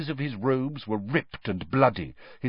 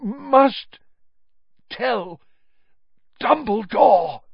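higher, broken, slow strain; terrible effort; 12 semitones higher, 7 dB louder, much slower than usual. A narrator's reading. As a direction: wrench each word out. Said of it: "cost him a terrible effort".

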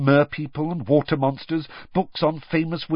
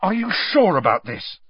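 raised, urgent doubt; higher-pitched worried question; frantic; 6 semitones higher than usual. A narrator's reading. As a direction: press anxiously for reassurance. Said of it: "said Harry frantically".

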